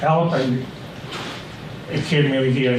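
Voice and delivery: ordinary talk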